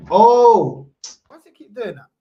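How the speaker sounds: loud, high, startled oh; annoyed surprise. Real, not acted. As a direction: react sharply to the glitch. Audio glitch keeps happening.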